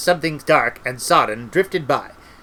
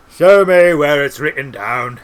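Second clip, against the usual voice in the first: slow, loud character voice; challenging demand; 6 dB louder, slower than usual. A narrator's reading. Cutangle challenging Treatle on the law, slow demand. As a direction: demand proof slowly and forcefully.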